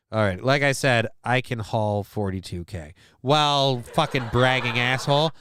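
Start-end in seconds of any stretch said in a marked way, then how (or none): none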